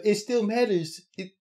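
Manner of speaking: high, insistent mock Beth; hammed-up insistent Beth; imitating Beth.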